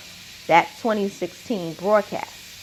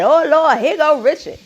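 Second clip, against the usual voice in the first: raised, louder mock announcement; imitating a mocking jab, pitched up; mocking quote; 7 semitones higher, 5 dB louder than usual. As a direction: call it out higher, poking fun at the man. Mimicking Chris Rock's mocking line.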